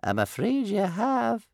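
put-on high-pitched, regretful old character; sad old timekeeper. A narrator's reading. Old Wrinkly confirming the chiefs lost, sad high voice. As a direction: break bad news regretfully in a high voice. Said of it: "he said sadly".

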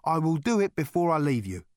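firm resolve. A narrator's reading. Rooster tells Zaphod of his one job, resolute. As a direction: state it briskly and with force.

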